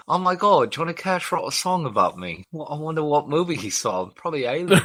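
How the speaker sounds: put-on English accent of an actor. Imagining John Hurt hearing the song, English accent. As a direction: play a self-absorbed Englishman. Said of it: "How is your English accent clearer".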